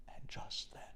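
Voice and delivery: whispered storyteller's pause; suspense whisper. A narrator's reading. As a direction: drop to a breathless whisper before the twist. Suspense before soldiers burst in.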